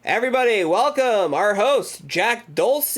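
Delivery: a lightly hammed-up host fanfare; game show host intro. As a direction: announce him like a hyped TV host. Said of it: "And he's like".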